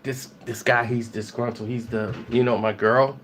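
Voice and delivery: a low, muttered complaint to the cops; Jason complaining. Retold or imitated. Jason tells the cops about the ex, low and muted. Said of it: "he's like".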